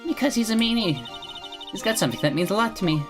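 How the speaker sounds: put-on high, sweet girlish voice; bright innocent animal tamer girl; cheerful young girl voice.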